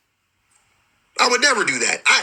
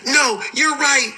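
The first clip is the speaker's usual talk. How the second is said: mock egging on; 8 semitones higher, slower than usual.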